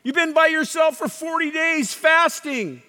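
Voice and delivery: loud, high challenge; taunting devil. Acted. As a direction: jeer at a starving man as the devil. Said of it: "That's what the devil was saying".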